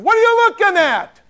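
high, loud angry challenge; angry retold shout. Soldier confronting staring bus passengers, angry and loud. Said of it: "And then he got kind of angry".

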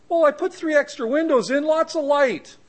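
high, airy excuse-making voice; breezy contractor. Retold or imitated. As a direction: play the careless builder brushing it off. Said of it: "he goes".

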